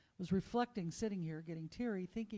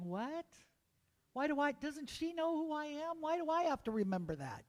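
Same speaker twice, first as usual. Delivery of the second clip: lightly voiced puzzled little kid; a hint of a baffled child asking why; confused kid; 7 semitones higher, faster than usual. Little kid questions mother's odd reminder, puzzled. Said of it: "I remember being a little kid going".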